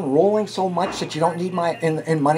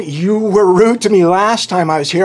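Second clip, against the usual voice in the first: yelling at her; 6 dB louder than usual. Real, not acted. Taxpayer argues with town clerk about recording her, yelling. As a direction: raise your voice and insist on it. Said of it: "Don't yell at her".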